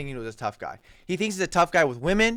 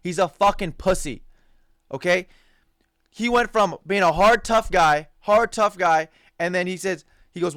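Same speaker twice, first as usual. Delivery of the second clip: ordinary talk, nothing marked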